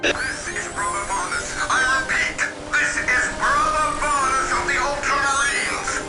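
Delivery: urgent military voice over a radio link; crackly battlefield transmission; radio distress call. Acted. Radio distress call from crashed marine.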